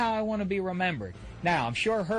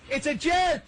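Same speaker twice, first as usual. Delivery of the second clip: high, loud comic insult; mock outrage at the movie channels; shouted punchline; 9 semitones higher, 4 dB louder than usual. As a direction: yell the punchline. Catchphrase punchline about TV.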